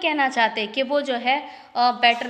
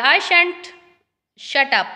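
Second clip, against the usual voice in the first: reading each English line slowly and carefully; slow play reading; 4 dB louder, much slower than usual. Acted. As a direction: read it out like a play script, word by word. (धीमी, साफ़ नाटक पढ़ाई)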